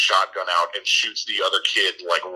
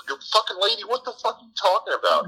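mock outraged yell; 13 semitones higher, faster than usual. [name] yells at lady.

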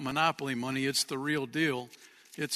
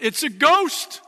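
much higher and louder, scared shout; terrified cry; 10 semitones higher, 8 dB louder, slower than usual. A narrator's reading. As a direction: cry out in fright at a ghost. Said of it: "they cried out".

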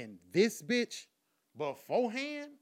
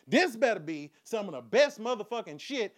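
ordinary delivery

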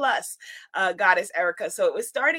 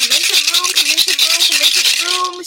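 calling out; 5 semitones higher, 10 dB louder, faster than usual. Real, not acted. Calling viewers into the stream.

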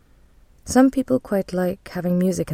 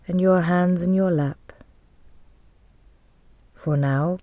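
guided meditation. Real, not acted. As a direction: guide them gently into calm, leaving space to breathe.

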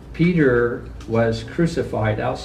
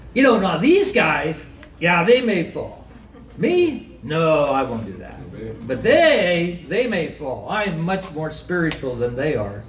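lampoon a self-righteous boaster; mock superior disciple; mocking arrogant Peter. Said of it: "the arrogance in that".

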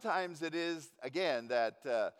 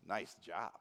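low, softer sarcastic praise; 8 semitones lower, 6 dB quieter than usual. Shown as a demonstration. Passer-by who sees a total mess, sarcastically. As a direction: drop it low and mean the opposite. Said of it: "with the tone of their voice".

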